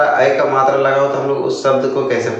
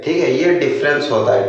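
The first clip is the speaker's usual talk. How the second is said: usual speaking voice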